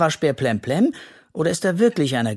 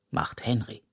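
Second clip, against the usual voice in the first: ordinary delivery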